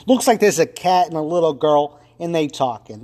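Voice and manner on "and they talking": usual speaking voice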